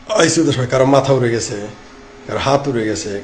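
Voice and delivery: normal talking voice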